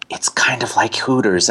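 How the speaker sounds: usual speaking voice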